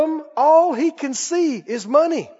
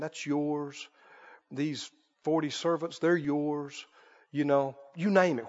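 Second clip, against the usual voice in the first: low, soft deal-making voice; acted confidential sales pitch; hushed bribe offer; 7 semitones lower, 12 dB quieter than usual. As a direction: tempt them quietly with riches.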